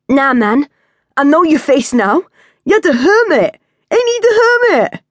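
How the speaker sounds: high, loud jeering youth voice; mock him in front of the gang; taunting street kid. A narrator's reading. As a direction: street kid sneering at the hermit.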